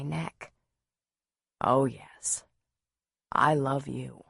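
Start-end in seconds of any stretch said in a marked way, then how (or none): 1.6-4.2 low-pitched tender declaration; intimate, into her ear. A narrator's reading. Man declaring love while kissing her neck, intimately. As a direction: sink low and confide it tenderly. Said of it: "he said into my ear".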